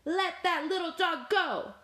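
high, mild storybook scream; screamed demand. A narrator's reading. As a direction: cry out to save a friend. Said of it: "Cow screamed".

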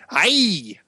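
high, cartoonish fake Mexican accent; mock Speedy Gonzales accent. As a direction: mimic the trailer's exaggerated accent. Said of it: "weird Speedy Gonzalez voice".

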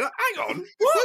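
high, cracking laughing outcry; squeaky laughing disbelief. Real, not acted. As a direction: hang on, what, up high. Outraged disbelief at clip.

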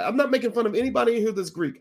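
usual speaking voice

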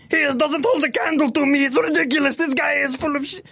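cocky Muppet trashing a rival; Pelota Muppet voice. Retold or imitated. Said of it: "switch ... between Pelota and Messi".

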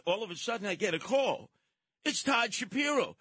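ordinary delivery

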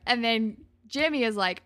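usual speaking voice

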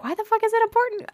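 high exasperated voice. Retold or imitated. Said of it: "I was like".

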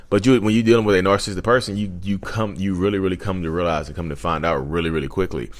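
ordinary talk, nothing marked

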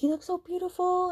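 put-on girly voice. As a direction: gush like a young girl. Madeline's quote, high.

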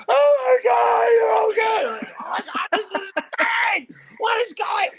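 high, loud ecstatic shrieks; imitating his wife. Wife jumping up as her husband escapes, shrieking with joy. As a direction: squeal it like a thrilled wife. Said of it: "his wife was standing up like".

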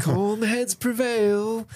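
high, smooth voice, soothing a man with a gun; Leonard impression. Podcast host voicing Leonard to calm Richie, high and smooth. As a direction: play the unflappable schemer talking Richie down.

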